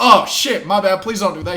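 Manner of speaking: high, loud, startled apology; retold alarm. Fighter told he could be disqualified, alarmed pleading. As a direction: act out a sudden pleading backpedal.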